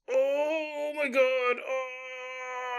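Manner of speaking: slow, drawn-out high groan of pain. Acted. Husband writhing in bed with groin pain, slow pained moan. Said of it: "Boris is like".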